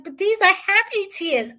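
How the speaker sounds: high imitating of happy tears; mock teary plea. Imitating the girlfriend defending her tears, mock teary. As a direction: squeak it like she's crying. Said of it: "She's like".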